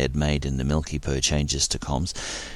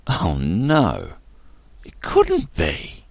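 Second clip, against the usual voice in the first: dismayed realisation; 3 semitones higher, much slower than usual. A narrator's reading. Captain realises who arrives.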